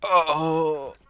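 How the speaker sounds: high, loud shocked oh; startled gasp. Real, not acted. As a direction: catch yourself in shock. Reacts to own slur.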